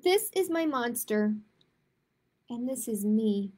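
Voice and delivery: ordinary delivery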